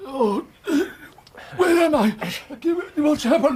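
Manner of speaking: high, loud confused waking; dazed alarm. Acted. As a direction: come to with a startled, bewildered cry. Coming round after fainting.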